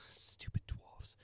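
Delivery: slow, unvoiced whispered insult; under his breath. Merchant insulting dwarves under his breath, whispered. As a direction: hiss it so they can't hear. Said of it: "he says that under his breath".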